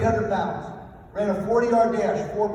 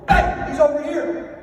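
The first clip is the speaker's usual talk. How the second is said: calling out; 5 semitones higher, 5 dB louder, slower than usual. Retold or imitated. Retold digger calling out.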